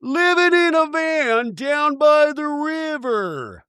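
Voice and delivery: high, slow, booming mock motivational speaker; comedy quote. Quoting the van-down-by-the-river bit, slow and high. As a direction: drag it out slowly, pitched high.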